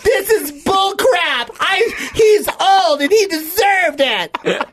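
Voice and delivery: high, slow outraged protest; struggling against restraints. Acted. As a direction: strain against the cuffs. Acting arrested teen.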